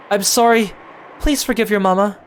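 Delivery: put-on a mother pleading with her child, mild tears. Rosa sobbing apology.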